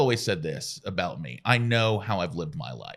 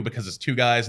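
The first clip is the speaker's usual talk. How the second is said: normal talking voice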